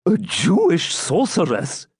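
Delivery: high, slow, disbelieving repeat; shocked echo. A narrator's reading. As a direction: feign horror at the word. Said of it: "echoed Albert Malvoisin".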